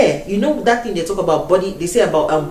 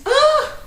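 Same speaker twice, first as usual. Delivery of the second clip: shocked gasp. Retold or imitated. Shocked by fast Welsh speech.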